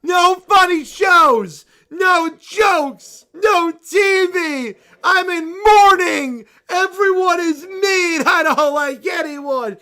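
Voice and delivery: shouting tantrum. Acted. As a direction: scream it at her like a kid refusing. Bear screams mom away.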